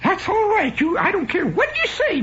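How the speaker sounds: very high, strident aunt impression; hammed-up aunt. Aunt overruling her younger sister, hammed-up falsetto. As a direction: scold in a squawky falsetto. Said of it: "my Aunt Teresa saying".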